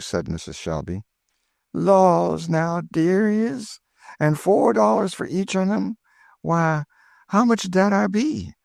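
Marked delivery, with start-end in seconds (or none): 1.7-8.5 put-on much higher, thrilled old cook; Aunt Chloe delighted. A narrator's reading. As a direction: burst with joy, high and eager. Chloe voiced by the narrator.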